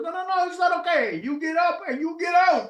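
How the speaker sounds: high, heated Italian owner's voice; angry Italian accent. Retold or imitated. Restaurant owner berating a customer, Italian accent. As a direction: fire it back in a thick Italian accent. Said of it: "He goes".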